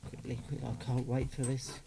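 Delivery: ordinary delivery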